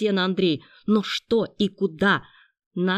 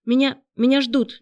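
hesitant, higher and louder; uneasy higher-pitched fib; uncertain lie; 6 semitones higher, 7 dB louder than usual. A narrator's reading. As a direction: stumble into an unconvincing excuse. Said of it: "неуверенно солгала девушка".

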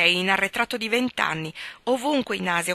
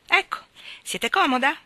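bright, higher cheerful offer; 7 semitones higher than usual. A narrator's reading. Monk settling the narrator into his car, cheerfully higher. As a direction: chirp it up pleasantly. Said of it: "chiese allegramente".

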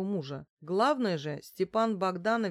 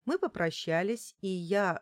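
ordinary delivery

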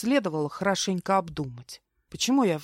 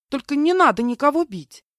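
higher, louder pleading cry; frightened plea to stop the fight; fretful wailing; 7 semitones higher, 8 dB louder than usual. A narrator's reading. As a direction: beg them to stop, alarmed. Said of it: "и запричитала".

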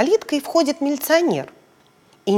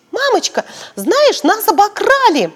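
put-on child shouting; 10 semitones higher, 5 dB louder than usual. Belochka shouting to mum, high child voice. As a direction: call out to mum as a little girl. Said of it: "Белочка ей кричит".

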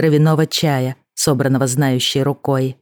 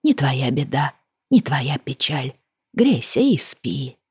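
softer, slow, calm and tender; soft lulling voice; 3 dB quieter, slower than usual. Acted. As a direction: lull her to sleep. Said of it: "шептал невидимый голос, спокойный и ласковый".

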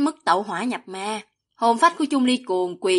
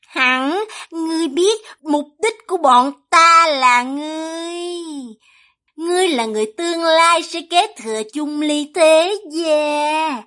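high, drawn-out villainess voice; slow, theatrical villainess taunt in a raised pitch; taunting demon villainess; 5 semitones higher than usual. A narrator's reading. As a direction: toy with your prey, stretching the key words.

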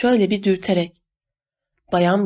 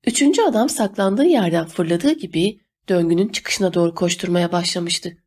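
usual speaking voice

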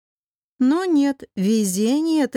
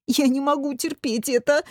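whimpering; 4 semitones higher than usual. A narrator's reading. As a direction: whine pitifully about unbearable pain. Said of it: "захныкал Одинцов". (хнычет, жалобно и высоко)

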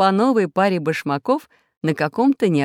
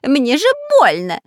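pained yelp; 12 semitones higher, 6 dB louder than usual. A narrator's reading. Spy falls into cactus.

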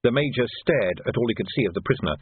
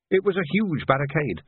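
plain reading voice

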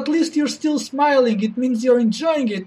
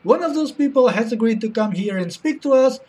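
ordinary talk, nothing marked

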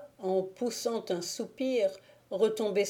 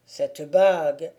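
lower, slow, heavy man's voice; troubled young man speaking low; Thibault, troubled; 3 semitones lower, 5 dB louder than usual. A narrator's reading. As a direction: drop into the brooding wolf-leader. Thibault answers Agnelette.

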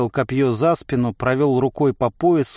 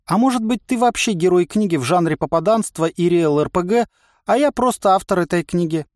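higher-pitched, whimsical voice in the head; chatty inner voice; 8 semitones higher than usual. A narrator's reading. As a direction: muse playfully through one theory after another. (болтливый внутренний голос, повыше)

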